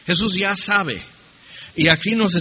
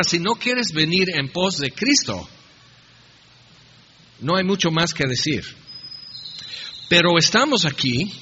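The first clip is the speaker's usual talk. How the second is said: ordinary talk, nothing marked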